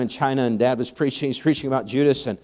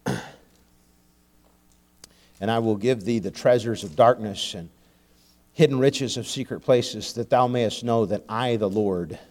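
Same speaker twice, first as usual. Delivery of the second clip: lower, softer solemn reading of Isaiah; scripture reading; 6 semitones lower, 5 dB quieter than usual. Acted. Preacher reading Isaiah to congregation, solemn scripture reading.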